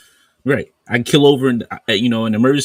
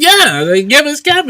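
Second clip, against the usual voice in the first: "Yeah, they gave us Kevin" pitched up and louder, gleeful; excited high reply; 8 dB louder than usual. Retold or imitated. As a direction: throw back the line, high and delighted. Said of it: "I said".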